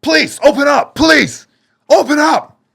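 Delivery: high, loud urgent shout; police yelling at the door, loud. Retold or imitated. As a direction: yell through the door to be let in.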